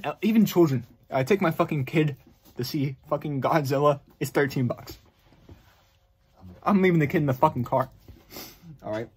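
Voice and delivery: ordinary talk, nothing marked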